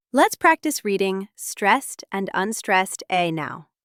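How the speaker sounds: ordinary talk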